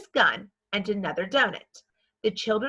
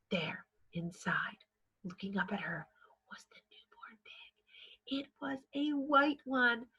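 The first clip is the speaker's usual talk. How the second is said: hushed reading; 9 dB quieter than usual. A narrator's reading. Reader hushing for the newborn pig, soft whisper. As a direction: drop to a near whisper for the reveal.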